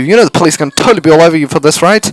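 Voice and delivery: loud, high and fast; shouting. Acted. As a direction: yell it out defiantly. Girl yelling at captor.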